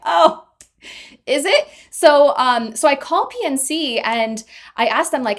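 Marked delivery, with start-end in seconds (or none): 0.0-1.7 high, innocent little "oh, is it"; mock wide-eyed surprise; naive surprise. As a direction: play the clueless kid who just found out. Naive reply to parents' scam warning.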